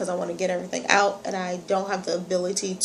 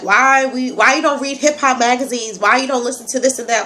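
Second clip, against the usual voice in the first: ordinary talk, nothing marked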